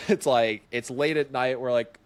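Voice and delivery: ordinary talk, nothing marked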